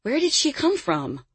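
high-pitched startled woman; startled question. A narrator's reading. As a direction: ask it in astonishment, pitch jumping up. She gasped.

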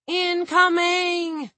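long drawn-out high yell; shouted warning. A narrator's reading. Kronsky warning the others of the incoming shell, high drawn-out shout. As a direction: yell it out, stretching each syllable. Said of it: "Kronsky shouted".

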